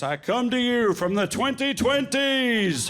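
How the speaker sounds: high, slow wizard proclamation; drawn-out, grandly mystical announcement. Acted. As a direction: intone it like a visiting wizard. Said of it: "So you do the wizard voice".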